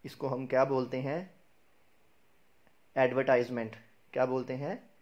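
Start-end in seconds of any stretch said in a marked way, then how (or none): 3.0-3.8 "एडवर्टाइजमेंट" with TIZE as in "eye"; the wrong ad-ver-TIZE-ment; common mispronunciation. Shown as a demonstration. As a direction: say "एडवर्टाइजमेंट" with a long "eye" vowel in the third syllable. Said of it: "एडवर्टाइजमेंट भी नहीं बोलते".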